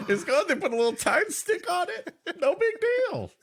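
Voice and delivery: high, breezy no-big-deal voice; mock casual worker. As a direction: shrug it off in a high, carefree voice. Mock casual worker shrugging off danger.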